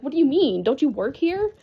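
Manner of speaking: high, loud, incredulous questioning; retold incredulity. Patient retorting to lost transport worker, incredulous. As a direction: throw the question back in disbelief.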